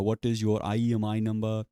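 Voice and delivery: mock Indian-accented support question. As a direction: put on an Indian agent's accent. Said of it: "racist Indian accent".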